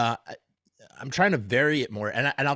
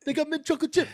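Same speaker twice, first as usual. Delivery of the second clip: much higher, strained; De Niro impression; 11 semitones higher, much faster than usual. Second half of the Mad TV flavor sketch, high strained voice. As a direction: push it up high and tight. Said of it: "my impression of an impression".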